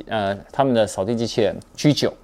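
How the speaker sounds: normal talking voice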